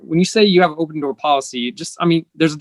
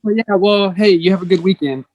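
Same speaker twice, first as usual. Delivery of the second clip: higher, breezy and dismissive, not listening; mock brush-off; 5 semitones higher than usual. Leader brushing off a struggling airman before the weekend, mock breezy. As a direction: wave them off cheerily to end the talk. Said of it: "respond genuine, show some empathy".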